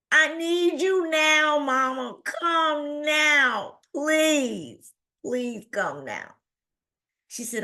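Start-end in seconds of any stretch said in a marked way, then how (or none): 0.1-6.3 high, drawn-out imitation of a tearful plea; desperate pleading. Daughter begging mother to come, desperate. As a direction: beg in a long, high whine.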